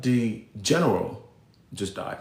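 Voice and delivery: low, slow and hushed, like breaking grave news; mother's hushed voice. Retold or imitated. Mother telling her son the general died, hushed and slow. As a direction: drop low and slow as the mom.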